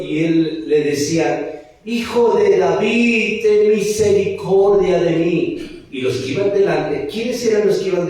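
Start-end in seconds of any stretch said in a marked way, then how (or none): 1.8-5.8 clamando despacio y fuerte; un ciego que ruega a gritos; súplica gritada. Acted. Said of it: "él le decía y les gritaba a Jesús". (grito de súplica lento)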